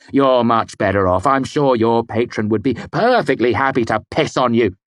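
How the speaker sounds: loud sarcastic barb; scathing. A narrator's reading. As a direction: sneer it. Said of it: "I said scathingly".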